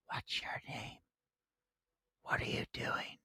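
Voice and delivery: breathless eerie whisper; creepy whisper. Retold or imitated. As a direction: whisper it like a ghost child. Imitating creepy kid in film.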